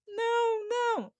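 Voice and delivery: put-on high, drawn-out childlike protest; helpless child. Shown as a demonstration. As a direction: plead like a defenceless little child. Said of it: "Olha aqui o comportamento da criança".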